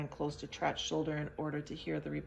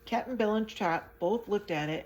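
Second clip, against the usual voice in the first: usual speaking voice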